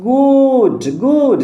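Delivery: গুড high, with the উ drawn out; long oo vowel. Shown as a demonstration. Teacher showing how double o sounds, long high উ in গুড. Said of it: "এই দুটো vowel মিলে উ হয়".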